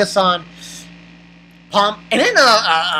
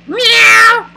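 loud high cat yowl; piercing mimicked cat cry; meow; 13 semitones higher, 8 dB louder than usual. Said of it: "And he went".

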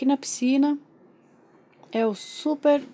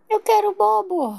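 put-on high, slow babyish whine; demanding baby; 9 semitones higher, 4 dB louder than usual. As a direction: whine for it like a toddler. Pretend baby demands something. (bebê pedindo com manha)

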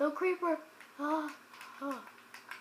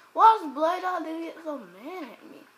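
ordinary talk, nothing marked

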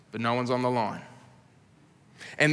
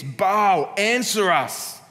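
raised, pleading call to a god; shouting; 5 semitones higher, 4 dB louder, much slower than usual. A narrator's reading. Prophets of Baal crying to their god, loud plea. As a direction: call out loud and desperate, slowing on answer us. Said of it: "they shouted".

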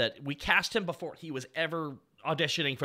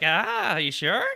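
lightly put-on actor voice, higher; imitating voice actor; 3 semitones higher, 6 dB louder, slower than usual. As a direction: imitate a doubting actor, a bit louder. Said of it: "he kinda sounds like this when he's talking".

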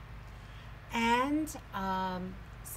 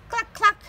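high, louder mock clucks; squeaky playful hen; chicken clucking; 13 semitones higher, 17 dB louder than usual. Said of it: "said Chicken".

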